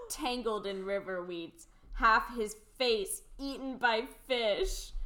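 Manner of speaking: usual speaking voice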